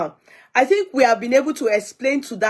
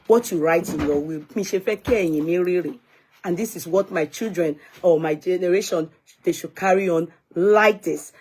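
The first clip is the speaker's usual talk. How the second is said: ordinary delivery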